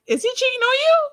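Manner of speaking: high, fast teasing squeal with a laugh; laughing falsetto tease; mock squeaky question. Real, not acted. Mocking question through laughter.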